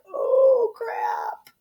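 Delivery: high squeaky oh, crap dropped away; mock-panicked whimper; teary mock dismay. Queen caught out by the letter, teary mock dismay. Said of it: "She's getting a little teary-eyed".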